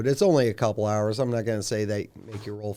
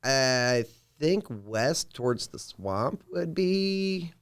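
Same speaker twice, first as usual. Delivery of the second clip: pondering guide Lander; 4 semitones higher than usual. Acted. Guide weighing which way to go, hesitant.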